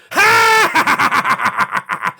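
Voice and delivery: loud cackling villain laugh; hammed-up evil cackle. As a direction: cackle like a maniac. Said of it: "He doesn't laugh".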